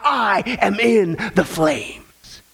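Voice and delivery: mock anguished cry. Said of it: "crying out".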